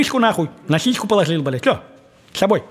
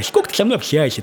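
ordinary talk, nothing marked

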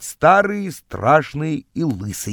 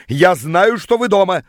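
moderately loud, higher yell; yelling through door; 5 semitones higher, 4 dB louder than usual. A narrator's reading. Rival shouting at the couple hiding inside, yelling. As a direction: call out accusingly through the door. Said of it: "стал орать он под дверью".

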